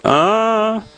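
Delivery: high mimicked "Ah!" cry; a very high imitated yelled "Ah" from the girl in the wheelchair. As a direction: hit a sudden very high "Ah!". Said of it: "I heard that girl yell out".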